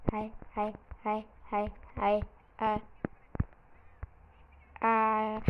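ordinary talk, nothing marked

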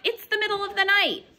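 lively storybook read-aloud with character voices; picture-book storytime voice; reading to children. Acted. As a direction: read it to a class of little ones. Teacher reads picture book aloud.

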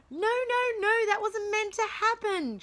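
shrieking teacher. Acted. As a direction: shriek in panic as the play goes wrong. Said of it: "shrieked Miss Pie".

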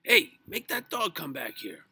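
high, loud demanding yell; angry shout. A narrator's reading. Mister Grumby after the dog with his roast, angry shout. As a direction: holler an order across the yard.

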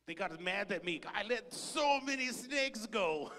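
hammed-up anguished shout; comic outburst. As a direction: confess it like a comic disaster. Comic confession, voice shoots up.

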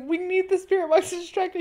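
imitating Zach. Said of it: "he's like".

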